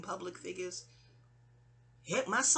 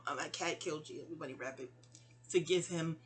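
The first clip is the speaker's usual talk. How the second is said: much softer, lower, gentle mock apology; 5 semitones lower, 8 dB quieter than usual. The mother apologizing to the bunny, soft low mock apology. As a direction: say sorry softly to the dead bunny. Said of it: "You know".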